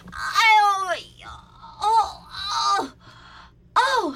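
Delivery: loud, high ow, then strained moans; imitated theatrical moaning; hammed-up groans of pain. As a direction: groan through a yoga stretch. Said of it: "他们一定会这样".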